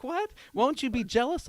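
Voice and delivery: high-pitched imitation of a surprised woman; mock surprise. Retold surprised reply from woman.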